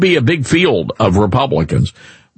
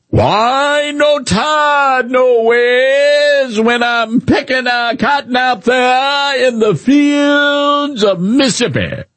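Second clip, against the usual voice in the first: high, slow, drawn-out fake Southern drawl, shouted; mock Southern accent; 9 semitones higher, much slower than usual. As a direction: ham up a folksy Southern twang to mock a politician. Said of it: "kinda like Hillary Clinton".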